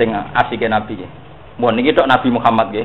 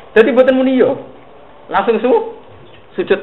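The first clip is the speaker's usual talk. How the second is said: raised emphatic preaching; 10 semitones higher than usual. Real, not acted. Preacher drives the point home.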